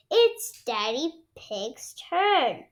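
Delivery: ordinary delivery, nothing marked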